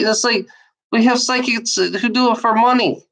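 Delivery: ordinary talk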